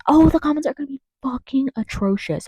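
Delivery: high-pitched exasperated outburst. Real, not acted. Ranting about news comment sections, exasperated. Exasperated rant, repeats itself higher.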